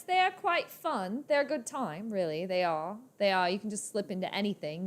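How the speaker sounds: playful British accent. Shown as a demonstration. Telling viewers accents are fun, in British accent. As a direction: slip breezily into British. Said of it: "Now you can go British".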